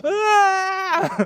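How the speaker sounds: high, wailing cry breaking through the words; reliving the sobbing. Retold or imitated. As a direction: wail it out like you can't stop crying. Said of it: "I was like".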